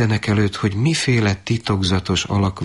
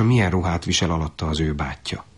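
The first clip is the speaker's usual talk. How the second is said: ordinary delivery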